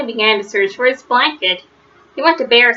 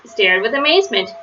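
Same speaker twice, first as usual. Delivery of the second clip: plain reading voice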